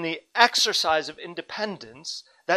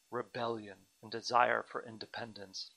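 usual speaking voice

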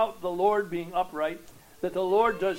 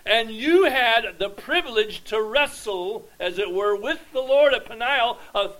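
loud, higher, emphatic proclamation; full-force preaching; 7 semitones higher, 11 dB louder than usual. Acted. Preacher reminding Jacob of God's care, at full force. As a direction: preach it out to the back row.

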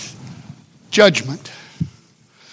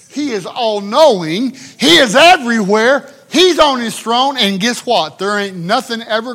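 higher and louder each line; rising preaching climax; 6 semitones higher, 7 dB louder than usual. Acted. Preacher exalting God, building louder and higher. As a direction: build to a shout.

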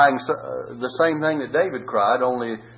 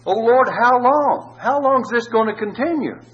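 much higher, louder pleading cry; desperate plea; 10 semitones higher, 4 dB louder than usual. Acted. David begging a silent God to answer, desperate. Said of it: "Seemed like that God wouldn't answer".

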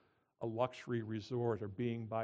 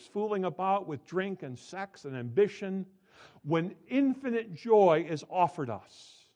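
preaching quote; 4 semitones higher, 7 dB louder than usual. Acted. Reads C.S. Lewis quote forcefully.

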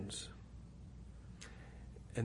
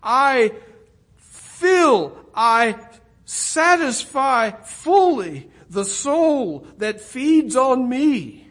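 higher, louder, slow and ringing; voicing Jesus, preaching; 8 semitones higher, 11 dB louder, slower than usual. Preacher voicing Jesus promising satisfaction, loud and high. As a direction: proclaim it as a promise. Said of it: "Jesus would say".

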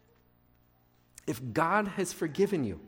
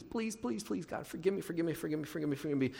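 mock begging; 6 dB quieter, faster than usual. Sinner on their knees asking God for forgiveness, acted begging. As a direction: beg softly, repeating it like a desperate penitent. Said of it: "I've got to beg".

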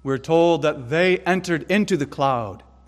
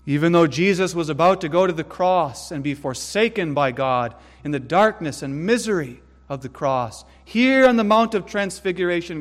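ordinary talk, nothing marked

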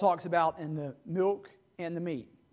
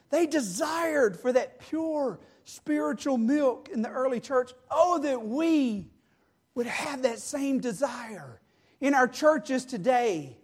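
higher, stretched-out pleading sermon delivery; impassioned preaching; 5 semitones higher, 8 dB louder than usual. Real, not acted. Preacher yearning for the early church's desire, impassioned.

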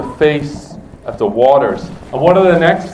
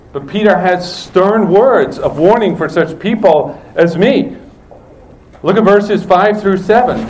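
usual speaking voice

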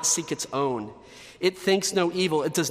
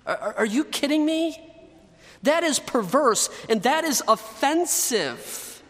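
outraged disbelief; 8 semitones higher than usual. Real, not acted. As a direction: cry out in indignant disbelief at the congregation. Preacher outraged at flattering seducer.

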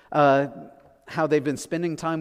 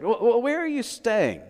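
evasive; 8 semitones higher than usual. Acted. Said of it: "so they changed the subject".

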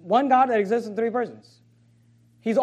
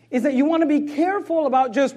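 ordinary talk

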